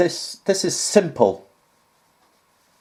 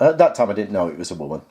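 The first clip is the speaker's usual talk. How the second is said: ordinary talk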